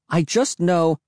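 high-pitched moaned protest; moaning. Acted. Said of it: "I moaned".

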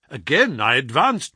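usual speaking voice

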